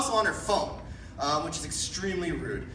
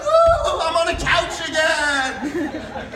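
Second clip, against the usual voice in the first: high, loud whoop into slow giddy line; jump-on-the-couch excitement; Tom Cruise whoop; 9 semitones higher, 8 dB louder, much slower than usual. Retold or imitated. Tom Cruise celebrating the inauguration, high and giddy. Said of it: "Tom Cruise is just like".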